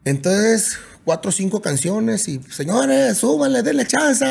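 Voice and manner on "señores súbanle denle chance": higher, brighter polite plea to the crew; playing nice. Singer imitating his polite request to the sound crew. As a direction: sweeten it like a nice guy asking a favor. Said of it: "haciéndola de buena gente".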